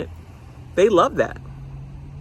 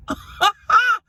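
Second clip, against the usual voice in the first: high laugh; 4 dB louder than usual. Real, not acted. Laughing after whisper.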